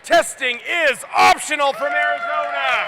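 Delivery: loud arena announcer intro; loud announcer introducing alliance teams; match announcer. Acted. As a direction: boom the team names to the crowd.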